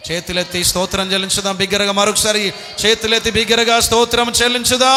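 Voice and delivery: loud, high call to praise; shouting praise call. Real, not acted. Preacher urging the crowd to praise aloud, shouting. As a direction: rouse the congregation with a shout. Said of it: "చేతులెత్తి బిగ్గరగా స్తోత్రం చెల్లించుదాం".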